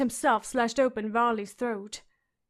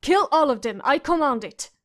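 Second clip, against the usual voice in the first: louder shrieked commands; screamed; 3 semitones higher, 7 dB louder than usual. A narrator's reading. Boy king orders his guards, screaming. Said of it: "the boy king screamed".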